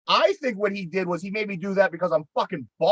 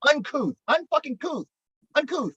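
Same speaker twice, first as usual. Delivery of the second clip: emphatic repeat; 9 semitones higher than usual. Real, not acted. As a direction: spit out the word uncouth high and mocking. Repeating the hard word emphatically.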